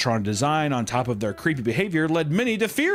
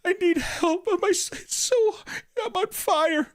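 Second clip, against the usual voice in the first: much higher pleading dead mother voice; ghostly mother; 11 semitones higher than usual. Retold or imitated. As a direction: beg for help like a burning ghost. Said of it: "your mother's voice".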